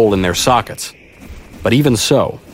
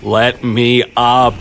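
slow, groaned plea; pained, drawn-out protest; groaning; 3 semitones higher, much slower than usual. A narrator's reading. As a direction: drag the words out in a groan. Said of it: "I groaned at the snow".

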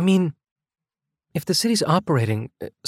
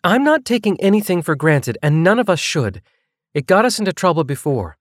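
higher female voice, insistent; raised pitch, pressing the point hard; increasingly forceful; 5 semitones higher than usual. A narrator's reading. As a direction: push back with growing force. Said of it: "Her increasingly forceful tone".

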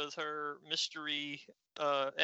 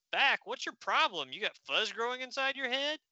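high, disbelieving scoff; mock outrage at his idea; raised-pitch incredulous snap; 8 semitones higher than usual. Incredulous retort.

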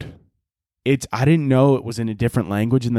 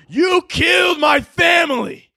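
much higher, loud hammed-up dramatic cry; overacted dub shout; 14 semitones higher, 3 dB louder, slower than usual. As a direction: scream it like an over-committed voice actor. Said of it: "I would be like".

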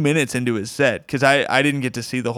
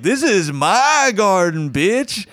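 high, drawn-out imitated heckler; mimicked territorial outburst; mock indignant retort; 7 semitones higher, much slower than usual. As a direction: stretch it out, pitched up, claiming your turf.

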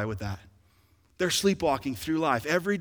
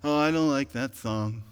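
bored churchgoer; 4 semitones lower than usual. Acted. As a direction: complain half-heartedly, going through the motions. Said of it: "not just walk in and go".

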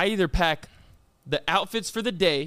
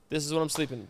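lower, softer throwaway; quiet aside; 6 semitones lower, 7 dB quieter, much faster than usual. Retold or imitated. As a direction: drop it low and soft. Quoting own packing, dropped aside.

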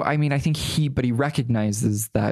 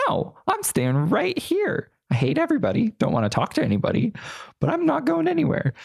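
high, sassy, defiant outburst; sassy defiance; 14 semitones higher than usual. Retold or imitated. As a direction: fling it back like a stubborn teen refusing to budge. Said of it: "my little sassy side".

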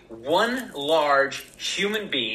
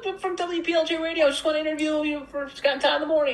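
very high, quick, squeaky self-parody; little squeaky voice; 14 semitones higher than usual. Acted. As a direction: squeak it out like a harmless pleader. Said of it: "me with my little squeaky voice".

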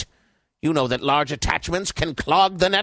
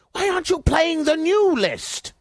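angry; 10 semitones higher than usual. Acted. Boss scolds employee.

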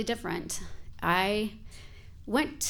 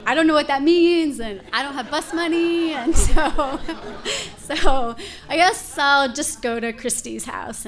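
higher, louder, laughing panic; teen runaway laughing at her own panic; panicky laughing; 7 semitones higher, 10 dB louder than usual. Retold or imitated. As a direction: spill it out high and giggly, flustered. Runaway teen panicking about next step.

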